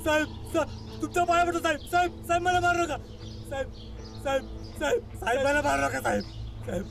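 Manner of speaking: high, sobbing begging; crying plea for mercy. Acted. Accused begging the officer not to beat him, crying. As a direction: beg for your life through tears.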